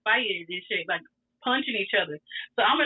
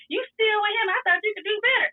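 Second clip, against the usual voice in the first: put-on higher, indignant boyfriend; jealous demand; 11 semitones higher than usual. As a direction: push him up high and accusing. Boyfriend confronting her, mad.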